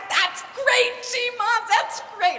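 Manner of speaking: put-on high, thrilled child; grandson's high delighted praise; delighted kid voice. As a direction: gush like a delighted little boy. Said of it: "And he's like".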